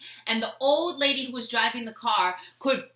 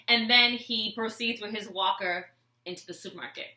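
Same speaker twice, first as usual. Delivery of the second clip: normal talking voice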